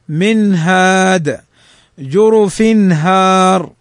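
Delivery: each example slowly, nun and tanwin fully sounded; slow clear recitation. Shown as a demonstration. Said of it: "تنطق كما هي". (قراءة بطيئة واضحة للأمثلة مع إظهار النون)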